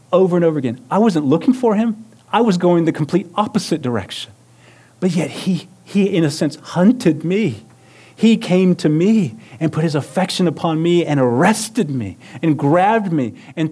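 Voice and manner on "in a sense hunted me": ordinary talk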